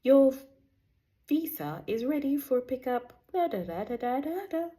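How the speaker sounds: stiff recorded announcement voice; automated voice. Retold or imitated. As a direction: read it like a phone robot. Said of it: "Then it's an automated voice".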